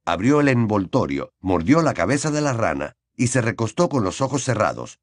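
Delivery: usual speaking voice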